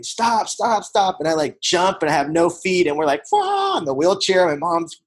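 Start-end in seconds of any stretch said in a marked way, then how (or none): 0.0-1.2 high mock yell of stop, stop, stop; mom yelling stop. Mom yelling at son racing wheelchair downhill, high mock yell. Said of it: "my mom's yelling at me". 3.3-3.8 very high yelped wah; mock wail. Mother and son flying downhill in wheelchair, very high squealed wah.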